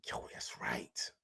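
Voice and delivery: slow, breathy whispered aside under his breath; hushed aside. Real, not acted. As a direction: drop to a slow whisper, just for himself. Slow aside while reading chat.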